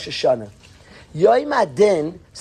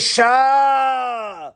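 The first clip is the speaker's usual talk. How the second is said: protesting angels; 6 semitones higher than usual. Acted. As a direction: call out one long high "shah". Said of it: "and one says".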